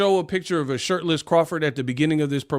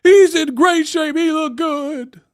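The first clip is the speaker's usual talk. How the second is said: high, loud, slow whiny mock voice; squeaky parody of an upset fan; 12 semitones higher, 7 dB louder, slower than usual. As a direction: plead it like a mad fan. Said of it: "they gonna get mad".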